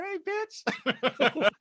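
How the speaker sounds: very high squeaky little voice; high-pitched mock little person voice saying hey; tiny high voice. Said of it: "She said".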